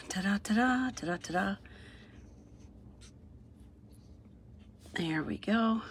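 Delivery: soft unvoiced whisper, almost to herself. Real, not acted. Crafter whispering to herself while finishing the coloring. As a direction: breathe the ta-das out under your breath.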